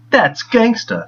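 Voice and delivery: hammed-up slow Deadshot quote; imitating Deadshot. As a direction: drag out the line like a cringey catchphrase. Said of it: "he goes".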